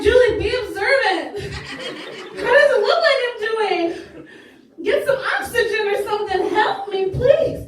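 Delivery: high, loud mock desperation; exasperated plea. Winded worker begs oblivious coworker for help, loud exasperation.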